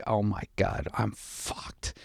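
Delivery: hushed, sinking to a near-whisper; dread-filled inner thought. Retold or imitated. Driver thinking to himself at gunpoint, hushed dread. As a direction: let the voice drop away as it hits you. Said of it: "In my head, I'm just like".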